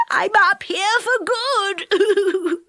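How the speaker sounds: high, slow, sobbing wail; crying. A narrator's reading. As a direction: sob it out, stuck for good. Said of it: "hearing Moonface crying".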